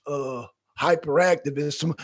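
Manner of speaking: usual speaking voice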